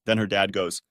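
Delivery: plain reading voice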